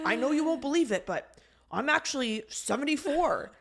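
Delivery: a lightly voiced boastful old guy; old man. Old man bragging age.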